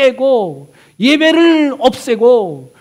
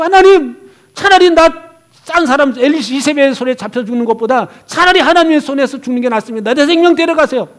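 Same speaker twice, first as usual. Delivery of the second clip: 더 크고 높게 하나님께 하소연하는 푸념; 죽여달라고 매달리듯 원망을 쏟아내기; 푸념하듯; 4 semitones higher, 6 dB louder, faster than usual. Retold or imitated. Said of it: "푸념하듯이". (지친 엘리야가 하나님께 원망하며 크게 푸념)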